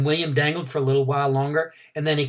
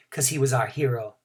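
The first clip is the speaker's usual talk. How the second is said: ordinary delivery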